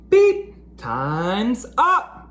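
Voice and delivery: high, slow announcer beep; announcing. Acted. Larry tells Stanley in the bathroom his time is up, announcing. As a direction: call time like a buzzer going off. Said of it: "Larry announced".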